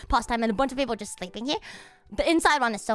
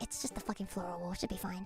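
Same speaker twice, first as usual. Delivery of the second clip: much softer, fast muttered thought; quiet reasoning; 5 semitones lower, 15 dB quieter than usual. Retold or imitated. Talking herself into showing the bathroom, muttering. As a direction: mutter it to yourself. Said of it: "I'm like".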